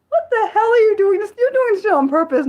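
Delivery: high, exasperated disbelief; shocked protest. Real, not acted. Streamer accusing Nick of trolling, high shocked protest. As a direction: protest like it's a prank.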